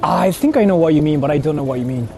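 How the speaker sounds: usual speaking voice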